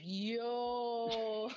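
mock-stunned, held-out protest; retold disbelief. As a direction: stretch it out, stunned by the insult. Retold stunned reaction to insult.

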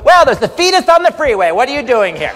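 camp driver voice. Retold or imitated. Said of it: "flung open the door".

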